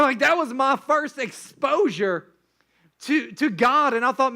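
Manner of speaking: usual speaking voice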